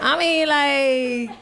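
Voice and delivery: drawn-out, exaggerated "like"; extra daughter. Daughter answering whether she's hungry, extra and drawn-out. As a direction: mimic an over-the-top daughter. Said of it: "she talks so extra".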